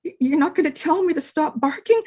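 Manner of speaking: fast, stammering, hopeful; nervous puppy; timid little dog. Acted. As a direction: ask timidly, surprised. Dog amazed.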